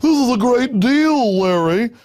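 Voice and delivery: high, slow, mincing mock Gore voice; hammed-up prissy dismissal; effeminate Gore mockery. Host mocks Gore waving off conspiracy claims, high and mincing. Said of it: "Al Gore and his whole effeminate things".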